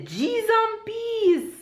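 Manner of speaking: very high, slow, laughing G's and peace; excited Jamaican exclamation. Shown as a demonstration. Vlogger demoing the Jamaican phrase in excitement, very high and slow. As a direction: draw it out high in delight, in Jamaican. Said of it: "in excitement".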